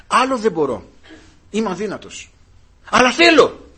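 a plea that climbs in pitch and loudness, peaking on θέλω; rising plea. Acted. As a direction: build it up to a high, loud θέλω. Preacher voices weak believer's plea. (κλιμακούμενη ικεσία, δυνατά στο «θέλω»)

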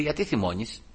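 soft, quiet question; gentle divine rebuke. Retold or imitated. The Lord questions the angry preacher, gentle rebuke. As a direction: gently call out the anger. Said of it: "ήρθε ο Κύριος και μου λέει".

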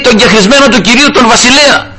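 high-pitched mock call, no real shout; retold calling out. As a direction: imitate a challenge called from a distance. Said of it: "άρχισε να φωνάζει ο Δαβίδ". (σαν να φωνάζει από μακριά, ψηλά)

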